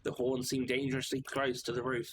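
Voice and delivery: usual speaking voice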